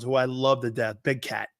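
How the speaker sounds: usual speaking voice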